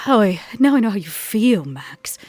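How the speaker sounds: ordinary talk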